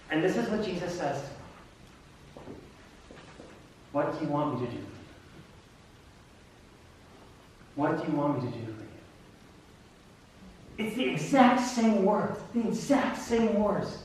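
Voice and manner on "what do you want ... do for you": hushed, soft asking with long pauses; gentle question. Acted. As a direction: ask softly and let it hang. Jesus asks the blind man, solemnly.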